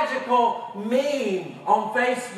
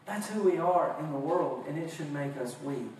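ordinary talk

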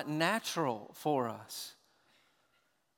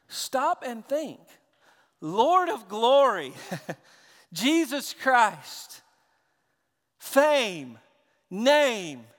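slow, loud, solemn listing; emphatic preaching; 5 semitones higher, 8 dB louder, much slower than usual. Real, not acted. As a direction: let each word ring out louder and slower. Preaching at full force.

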